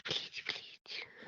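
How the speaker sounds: slow, hushed begging; whispered plea. Acted. Wife begs to be carried, whispered pleading. Coaxing him with hushed pleas.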